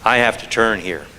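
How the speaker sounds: ordinary delivery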